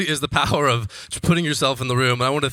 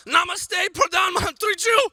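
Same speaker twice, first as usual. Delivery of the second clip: screamed greeting. Retold or imitated. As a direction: belt "Namaste, Pradhan Mantri Ju!" across the crowd, very high. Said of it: "So I just scream out".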